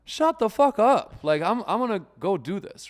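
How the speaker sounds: defiant shout back. Retold or imitated. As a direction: shout down the inner critic, slow and loud. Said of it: "I'm like".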